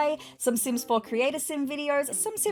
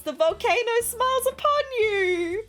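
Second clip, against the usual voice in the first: high-pitched proclamation as a shaman; mock-grand blessing, lifted high; lightly voiced shaman; 9 semitones higher than usual. Shaman blesses Jessica for saving the village, proclaiming.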